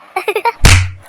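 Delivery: loud, high giggle; mocking laughter. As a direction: burst out laughing at a sibling. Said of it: "ரெண்டு பேரும் சிரிக்கிறீங்க".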